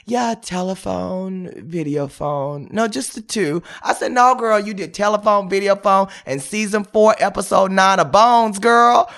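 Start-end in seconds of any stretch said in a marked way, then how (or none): 0.0-3.6 high, slow impersonation; drawn-out, lilting imitation of a pop star. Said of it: "She goes". 4.1-9.1 high, loud, sassy comeback; emphatic mock correction; playful insistence. Acted playful argument, insisting on three.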